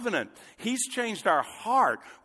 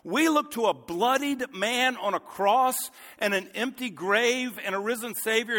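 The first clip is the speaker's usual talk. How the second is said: ordinary talk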